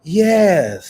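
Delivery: loud, high "Yes" held very long; drawn-out yes. Retold or imitated. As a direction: stretch "Yes" way out, exaggerated. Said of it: "listen how long they said".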